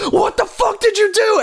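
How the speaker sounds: high-pitched imitated outburst; hammed-up outrage, voice leaping way up; mock angry demand. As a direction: act out an incredulous, furious confrontation. Retells enraged character confronting the driver.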